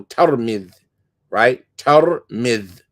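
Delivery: Tirmidh with an open a after the T, tar-midh; local pronunciation. Shown as a demonstration. Teacher repeating the locals' form of the town name. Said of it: "This is how they said it".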